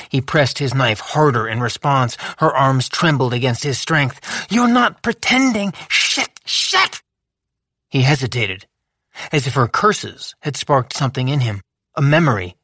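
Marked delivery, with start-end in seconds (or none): 4.5-7.0 high, cursing dismay; dismayed realisation. A narrator's reading. Lou to herself, high, cursing. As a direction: realisation hits hard.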